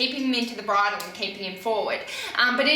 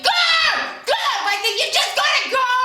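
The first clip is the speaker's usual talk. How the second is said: much higher, loud urgent coach yells; screaming go; 13 semitones higher, 4 dB louder than usual. Shown as a demonstration. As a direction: scream at the rider to push on. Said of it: "I'm just screaming".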